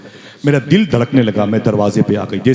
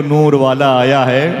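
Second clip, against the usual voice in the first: "नूर वाला आया है" drawn out slowly and lovingly, rising on नूर; said with love; 2 semitones higher, slower than usual. Shown as a demonstration. Preacher models it for the crowd: "नूर वाला आया है" slow and loving. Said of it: "जरा मोहब्बत से कह दो".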